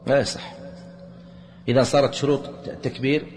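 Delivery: ordinary delivery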